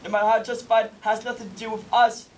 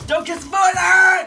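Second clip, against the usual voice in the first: loud, high, slow outburst; exasperated shout; 9 semitones higher, 8 dB louder than usual. Acted. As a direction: blow up and yell it at her. Official loses temper.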